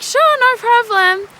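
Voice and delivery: high, loud and slow, bright customer-service voice; cheerful server. Retold or imitated. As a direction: chirp it like an eager new waitress. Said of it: "And I was like".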